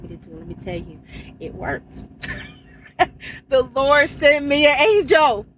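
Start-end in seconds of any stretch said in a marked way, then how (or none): 3.5-5.4 loud, high-pitched cry of testimony; exultant praise. Real, not acted. Trucker testifying that God rescued her, exultant praise. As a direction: shout it out in jubilant praise.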